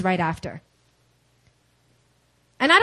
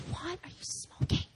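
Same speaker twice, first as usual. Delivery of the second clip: hushed, higher-pitched disbelief, played for laughs; incredulous; 6 semitones higher, 13 dB quieter than usual. Wife to husband who wants the apartment, incredulous. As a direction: ask softly in mock bewilderment.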